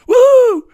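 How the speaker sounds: high, loud whooping laugh. Real, not acted. Host laughing at his own joke, a loud high whoop. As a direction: whoop with delight.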